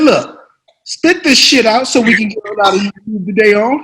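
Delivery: loud, high, forceful pep talk; Sam Jackson impression. Veteran actor coaching the nervous newcomer, forceful impression. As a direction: drill it into the rookie. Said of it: "He said".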